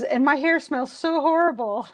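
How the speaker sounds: hammed-up dismay about her smelly hair; fretting heroine. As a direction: whine it like she's mortified.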